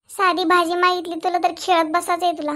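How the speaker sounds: normal talking voice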